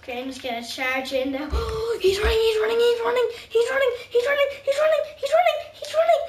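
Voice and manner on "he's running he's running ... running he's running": high, loud, building gamer panic; frantic excitement. Real, not acted. Player spots someone running in the game, frantic. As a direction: rattle it out, climbing higher with each repeat.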